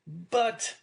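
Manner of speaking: high-pitched, startled objection; dismayed cry. A narrator's reading. Son learning of the paid debt, crying out in dismay. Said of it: "cried Dante".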